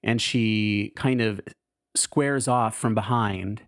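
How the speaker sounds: ordinary delivery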